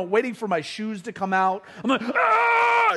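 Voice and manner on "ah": high, loud hammed-up scream of distress; mock scream. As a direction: shriek like a man being sick. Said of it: "just screaming and yelling".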